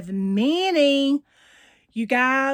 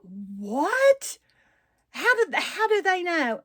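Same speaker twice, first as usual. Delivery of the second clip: higher-pitched, puzzled surprise; retold bafflement; 7 semitones higher, slower than usual. Woman recalls being recognized by strangers, baffled. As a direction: replay being caught off guard, pitch jumping up.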